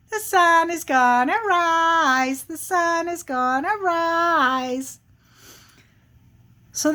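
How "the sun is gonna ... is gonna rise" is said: high, drawn-out singsong taunt; teasing little squirrel chant; smart-alecky squirrel. Acted. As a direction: needle the bear in a higher singsong chant. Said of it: "And the squirrel says".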